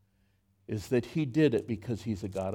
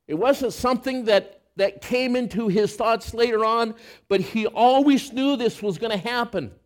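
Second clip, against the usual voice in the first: rising, louder preacher's push; preaching at full force; 9 semitones higher, 5 dB louder than usual. Acted. Pastor driving the point home to the congregation, preaching hard.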